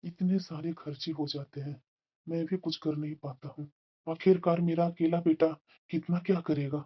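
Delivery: very soft, subdued musing; worried self-talk. Acted. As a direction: fret to yourself about the burden. Father worrying alone over bills.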